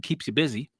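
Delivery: normal talking voice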